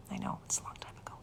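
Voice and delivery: soft whispered aside. Real, not acted. Teasing viewers about her age, whispered. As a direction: whisper it like a secret. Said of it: "unvoiced whisper aside".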